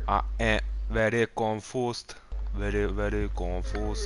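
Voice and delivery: slow, drawn-out confusion; slow confused drawl. Real, not acted. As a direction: stretch every word, baffled. Dragging out confusion.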